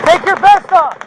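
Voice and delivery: shouting. Real, not acted. Urgent order during active shooter.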